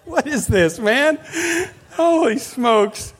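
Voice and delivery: loud, high astonished outburst; mock disbelief. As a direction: drag out the disbelief, loud and hammed-up. His reaction to golfer.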